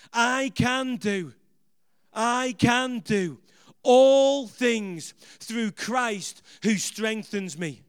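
drawn-out chant of the verse, every word stretched; slow call-and-response. Shown as a demonstration. Preacher leading the congregation in the verse, slow chant. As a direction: lead the crowd through it word by word. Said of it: "Come on, say it with me".

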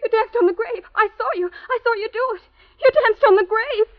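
high, mounting hysteria; hysterical horror. Acted. [name] accusing Kay of dancing on a grave, hysterical. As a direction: accuse in rising, horrified panic. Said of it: "[name], stop acting like that".

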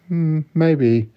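coy tease.

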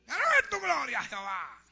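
high, loud mock fervent cry; shouting at the altar. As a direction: belt out a showy plea, easing at the end. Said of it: "gritando en el altar". (clamor exaltado imitado)

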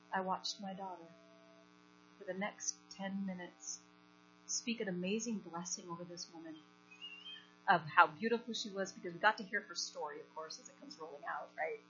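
ordinary delivery

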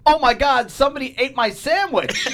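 high, loud mock alarm; loud staged outcry. Wife wanting the family to overhear, loud fake shock. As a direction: imitate wife announcing it for all to hear. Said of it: "so that they could hear that".

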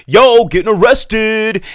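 loud, slow, high put-on voice; slow and loud like a careless star dude; mock Ezra Miller. Podcaster mocking a star's arrest, loud slow put-on voice. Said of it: "And then Ezra Miller's like".

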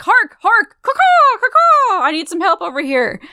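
high screeching bird cries; shrill screeching bird woman. Retold or imitated. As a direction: squawk it out in panic. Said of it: "freaks out and is like".

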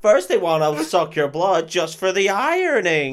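loud, high mock routine; hammed-up stand-up comic. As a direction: belt out the hack comic's punchline. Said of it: "Open mic nights".

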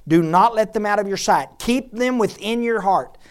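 loud, emphatic preacher's reading; forceful scripture reading. Acted. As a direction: proclaim the passage with punched key words. Reads scripture aloud with force.

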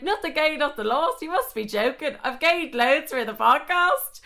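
high, incredulous retold retort; indignant comeback. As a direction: protest it, stretching out the last line.

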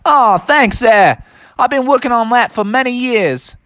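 high, hammed-up Aussie kangaroo; Australian accent. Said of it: "your Australian accent sure is awesome".

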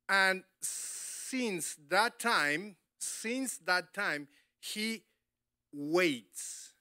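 very slow, weighty repetition; drawing out since that time; slow emphasis. Acted. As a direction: stretch each word out. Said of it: "drawn out for weight".

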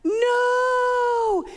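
high, loud wail of dismay; horrified no. Retold or imitated. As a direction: cry out in mock horror. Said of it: "I'm like".

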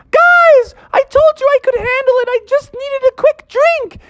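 very high little-frog yell; youngest little frog. Acted. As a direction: shout it in a tiny, high frog voice. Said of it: "the youngest little frog".

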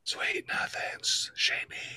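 whispered sweet nothings, slow. Pretend. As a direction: breathe it like a secret. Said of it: "whisper to me".

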